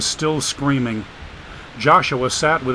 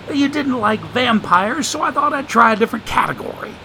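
put-on higher, sly voice of an old drifter; streetwise homeless man; 9 semitones higher than usual. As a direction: play the wisecracking drifter, amused. Joshua's voice in the story.

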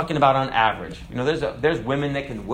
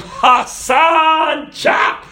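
much higher and louder, chop forced out in a hoarse unvoiced yell; shouted Hassan impression; 8 dB louder, much slower than usual. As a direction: bellow it like a cartoon executioner. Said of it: "God's coming around going".

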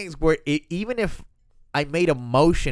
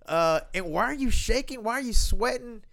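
high mock bewildered questions; a light mock of a confused wife; puzzled wife; 9 semitones higher than usual. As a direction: imitate her baffled. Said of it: "you were so puzzled".

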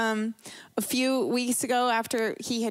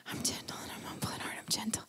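fast, much softer whisper; under my breath; 11 dB quieter, faster than usual. Retold or imitated. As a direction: whisper it quickly to steady yourself. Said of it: "I said it under my breath".

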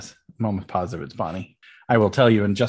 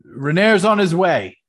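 raised, louder call from the bar; 7 semitones higher, 9 dB louder than usual. Acted. Barkeep waving at guests from behind the bar, calling out. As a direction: call the news across to the guests.

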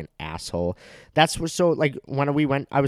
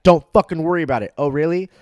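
louder, higher, fast mock standoff; imitated confrontation; 7 semitones higher, 7 dB louder, faster than usual. Imagining the dad's brush-off and his own comeback, hammed-up. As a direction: act out the threat, hammed-up.

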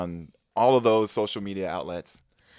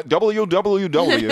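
radio voice; 11 semitones higher, 9 dB louder, much slower than usual. Shown as a demonstration. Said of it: "I don't have the radio voice".

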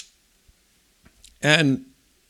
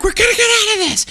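high, fast retold shout with a laugh; father shouting to kids, laughing as he retells it; yelling with laugh; faster than usual.

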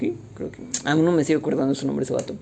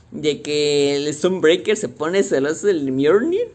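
ordinary talk, nothing marked

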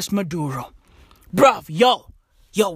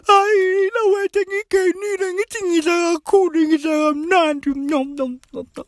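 mock glutton; 8 semitones higher than usual. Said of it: "they'll be like".

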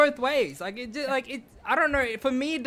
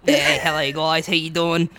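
slow, low Australian Bogan drawl; Bogan accent; 6 semitones lower, slower than usual. Shown as a demonstration. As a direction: introduce yourself as a laid-back Aussie Bogan. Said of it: "give us a good Bogan accent".

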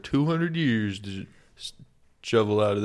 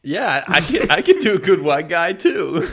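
much higher and louder, laughing into it; 7 semitones higher, 8 dB louder than usual. Retold or imitated. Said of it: "You're doing it".

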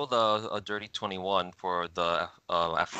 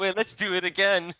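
put-on playground kid; 10 semitones higher than usual. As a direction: play an eager kid through giggles. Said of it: "he's that kid that at the fucking McDonald's playground".

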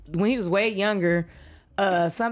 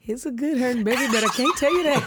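laughing, quick and a bit loud; laughing joke; 4 semitones higher, 3 dB louder, faster than usual. Retold or imitated. Mom joking about what she'd tell her kid, laughing. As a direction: tease it through a laugh.

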